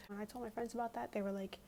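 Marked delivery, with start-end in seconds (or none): none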